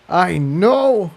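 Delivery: strained gritted reply; through gritted teeth. Acted. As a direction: force the words out under effort. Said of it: "replied through gritted teeth".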